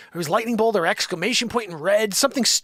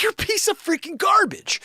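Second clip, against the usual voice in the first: much higher, mock-furious outburst; furious insult; 13 semitones higher than usual. Owner venting at the dealership, furious insult. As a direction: spit it at the dealer.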